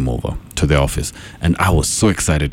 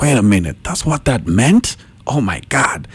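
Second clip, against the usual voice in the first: shocked realization; 8 semitones higher than usual. Retold or imitated. Acted sudden realization.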